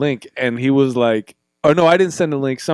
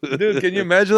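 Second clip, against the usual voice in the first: ordinary talk, nothing marked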